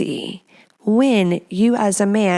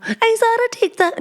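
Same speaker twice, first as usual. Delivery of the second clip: high-pitched mock Italian accent; sing-song attempt at an Italian accent; put-on accent. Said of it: "I need to find a new accent".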